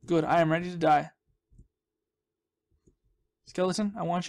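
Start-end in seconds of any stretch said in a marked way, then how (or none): none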